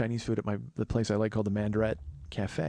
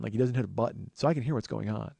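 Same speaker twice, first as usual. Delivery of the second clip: ordinary delivery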